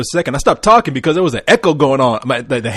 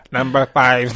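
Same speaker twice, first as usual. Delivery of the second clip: mock slow voice; 4 semitones lower, 4 dB quieter, much slower than usual. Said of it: "like a mentally ill person".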